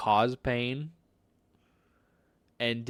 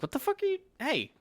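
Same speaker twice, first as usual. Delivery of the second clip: jumps high in pitch, startled; shocked outcry; 4 dB quieter, faster than usual. Retold or imitated. Retelling being bitten, a startled high outcry. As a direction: yelp it like you just got bitten.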